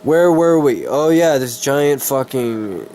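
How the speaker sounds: usual speaking voice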